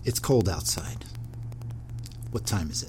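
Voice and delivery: soft, low improv narrator; hushed scene setting. Pretend. Host sets up an improv question, hushed scene setting. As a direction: set the scene in a near whisper.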